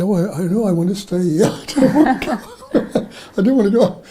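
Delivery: high, loud mock refusal with a laugh; retold girlish protest. Kidnapped girl tells her rescuers she wants to stay, retold protest. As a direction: protest like a child refusing to leave.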